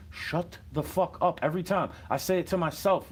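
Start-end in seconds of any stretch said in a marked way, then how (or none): 0.1-1.4 slow, drawn-out hush-up line; drawn-out mock retort. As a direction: drag out the shut-up, half under the breath. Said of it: "I feel like going".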